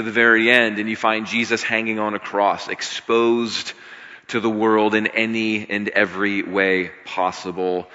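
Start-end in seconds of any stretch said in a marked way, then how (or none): none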